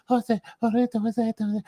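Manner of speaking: high, far-off mock sobbing "Jose"; mock crying wrestler. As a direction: whine it like a weepy wrestler.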